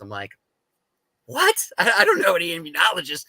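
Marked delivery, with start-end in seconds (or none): 1.2-3.2 high yelp, then rushed flustered words; startled disbelief. Retold or imitated. Told to find an immunologist, he answers in rushed high disbelief. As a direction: shoot up in pitch then hurry on, baffled. Stunned at the advice.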